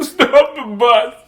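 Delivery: high, loud, drawn-out mock shout; exclaiming. Reader imitating teacher who isn't crying, exclaiming. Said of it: "She's, like, exclaiming".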